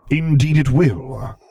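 slow, loud, weighty dark lord reply; Vader's voice. A narrator's reading. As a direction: lay down the final word menacingly.

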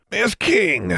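put-on high, thin voice of a cold old wizard; curt greeting from a hostile old man. Old wizard coldly greeting Miss King, high thin voice.

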